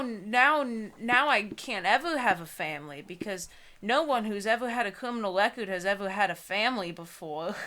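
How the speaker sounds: ordinary talk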